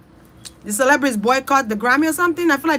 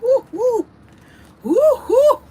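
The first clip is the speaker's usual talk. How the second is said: high, loud excited whoops, far above her usual voice; wowed whooping; 13 semitones higher, 6 dB louder than usual. Pretend. Viewers tasting her Nigerian food, whooping high and loud. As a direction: cheer like the food just blew your mind. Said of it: "Y'all gonna be like".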